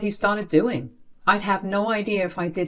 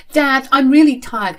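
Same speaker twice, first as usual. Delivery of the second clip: higher, louder tired plea; pleading; 7 semitones higher, 6 dB louder than usual. Acted. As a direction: beg to be let off. Daughter pleads to go to bed.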